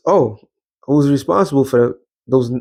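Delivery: usual speaking voice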